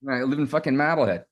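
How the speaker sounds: put-on a light Boston accent, r dropped in Marblehead; hint of a Boston accent. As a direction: say it like a Boston local, with a swear. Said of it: "Please pronounce your hometown in your bo-, best Boston accent".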